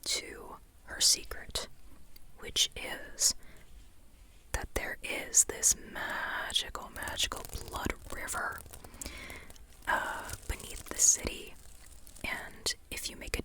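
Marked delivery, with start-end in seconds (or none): none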